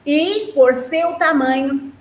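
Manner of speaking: slow, careful read-aloud; teacher reading the book's sentence to her class; reading from the text. Shown as a demonstration. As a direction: read it slowly so the children follow. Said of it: "fala assim".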